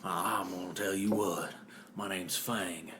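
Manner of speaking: lightly voiced movie villain; loose, inaccurate villain impression; Fang. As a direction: play the big bad in your own accent. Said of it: "It's not in a million years what he sounds like".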